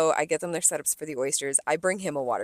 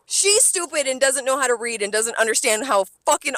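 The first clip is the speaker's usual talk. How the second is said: loud, higher furious venting; angry rant; 4 semitones higher, 4 dB louder than usual. Retold or imitated. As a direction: tear into her, loud and high. Ranting at the rude customer.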